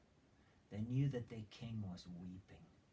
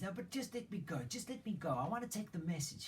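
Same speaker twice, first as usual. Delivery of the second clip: high, eager insistence; excited pleading; 7 semitones higher, faster than usual. Retold or imitated. Ahimaaz haggles with Joab to carry the message, eager. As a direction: plead like a boy who can't wait. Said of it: "He's just excited".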